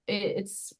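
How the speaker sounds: normal talking voice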